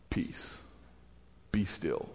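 very quiet, calm authority; calm command. Acted. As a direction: hush the storm, almost whispered. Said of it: "not even like he stood up and yelled".

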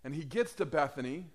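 usual speaking voice

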